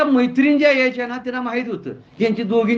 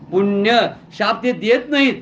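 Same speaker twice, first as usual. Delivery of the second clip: ordinary delivery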